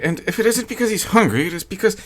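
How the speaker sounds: the wife fears the shelling, nervously, faltering. A narrator's reading. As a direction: rush it, then let the voice fail. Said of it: "she continued nervously".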